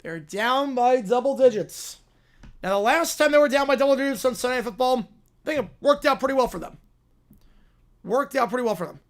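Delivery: lively TV announcer calling the drive; play-by-play call. Acted. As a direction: call the game like a network broadcaster.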